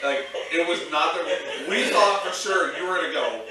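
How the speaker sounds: ordinary delivery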